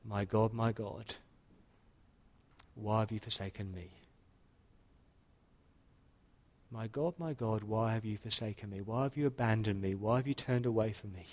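quiet, hushed voicing of the dying Jesus; mild retold cry. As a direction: softly imitate an anguished cry, understated. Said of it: "He cries out".

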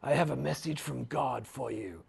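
sinister low hush; mock assassin. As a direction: drop low and quiet like a killer. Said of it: "He spoke in an American accent, I'm sure".

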